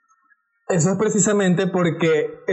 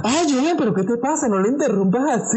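lightly voiced scolding mother, higher; a hint of a mother's reproach with a laugh; mom scolding; 8 semitones higher than usual. Mother scolding her son in the car, higher mock voice.

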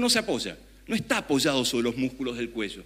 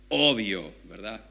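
ironic; 5 semitones lower, slower than usual. Shown as a demonstration. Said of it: "Ese obvio es irónico".

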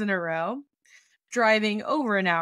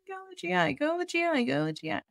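nagging friend; 8 semitones higher, 3 dB quieter, faster than usual. Retold or imitated. Haley voiced nagging.

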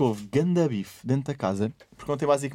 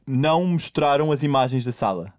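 ordinary talk, nothing marked

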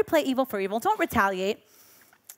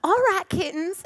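higher, lilting teacher greeting the kittens; teacher greeting her pupils, higher; sweet teacher; 7 semitones higher than usual. Retold or imitated. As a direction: imitate the sweet teacher calling her class. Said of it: "she used to call us kittens".

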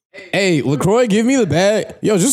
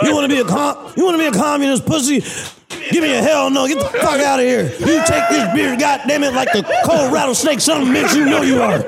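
wrestler shouting rant; 6 semitones higher than usual. Pretend. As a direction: yell it like a wrestling heel. Stone Cold berating customer.

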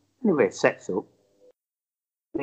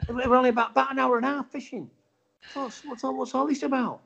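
high-pitched, puzzled disbelief; baffled; 10 semitones higher than usual. Retold or imitated. Angler wonders at the rival going home, baffled. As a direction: puzzle over it, pitched right up.

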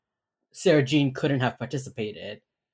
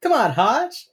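high, loud playful plea; mock whiny nudge to a stern colleague; teasing plea; 10 semitones higher, 5 dB louder, slower than usual. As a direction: coax the grumpy boss, pitched way up. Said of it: "and he goes".

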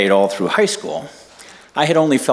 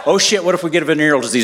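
retold panic; 6 semitones higher, much faster than usual. Retold panic, comic repetition.

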